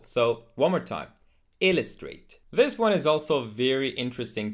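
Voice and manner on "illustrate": ILLUSTRATE as "IL-uh-strate"; first-syllable stress on illustrate. Shown as a demonstration. Teacher modelling illustrate for learners, IL stressed. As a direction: punch IL in "illustrate", weak middle. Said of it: "put the stress on the first syllable".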